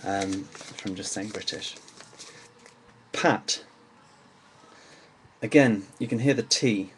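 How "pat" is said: "Pat" with a short A and clear T; English "Pat", short vowel, T pronounced; without the R. Shown as a demonstration. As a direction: say "Pat" with no R, sounding the final T. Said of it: "but without the R, the A, the vowel".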